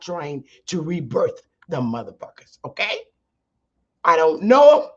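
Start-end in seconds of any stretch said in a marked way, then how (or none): 4.0-4.9 loud, forceful disavowal rising at the end; emphatic denial. Real, not acted. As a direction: push it out loud, disowning them. Emphatically disowning the organizations.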